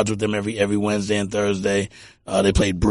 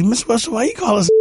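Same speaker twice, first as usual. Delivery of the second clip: put-on high-pitched kid asking an elder; imitated youngster, voice lifted high; questioning kid; 14 semitones higher, faster than usual. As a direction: plead like a puzzled child, pitched way up. Retells his question as a kid.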